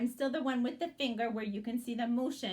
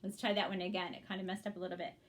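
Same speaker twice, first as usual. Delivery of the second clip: usual speaking voice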